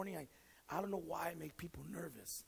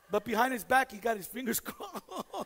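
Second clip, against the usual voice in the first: laughing; 6 semitones higher, 14 dB louder than usual. Real, not acted.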